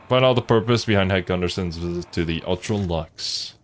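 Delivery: ordinary delivery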